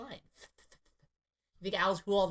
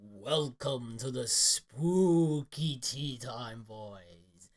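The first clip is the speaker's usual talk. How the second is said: slow, drawn-out spooky host; spooky intro voice; 3 semitones lower, 5 dB louder, much slower than usual. Pretend. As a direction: creep out the intro slowly. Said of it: "I'll put an intro in, ready?".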